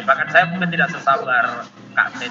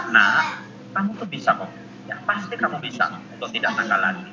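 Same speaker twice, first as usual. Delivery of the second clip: gentle encouragement; 7 semitones lower, 5 dB quieter than usual. Retold or imitated. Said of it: "Lebih baik kita berkata".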